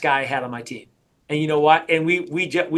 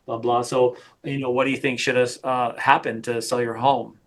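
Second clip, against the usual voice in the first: lower, flat mock of a rambling agent; droning sales agent; 5 semitones lower than usual. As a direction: imitate a dull agent rambling on and on. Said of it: "because you were talking like this, David".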